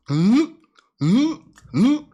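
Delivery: loud, high mm of delight; mock yummy hums. Acting out licking ice cream, loud happy hums. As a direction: hum like each lick is heaven.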